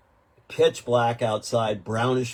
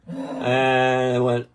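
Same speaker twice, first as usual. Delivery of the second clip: ordinary delivery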